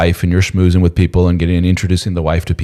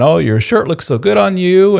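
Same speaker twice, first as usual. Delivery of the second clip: fawning flirt; 11 semitones higher, 3 dB louder than usual. Retold or imitated. Office flirt fawning over husband.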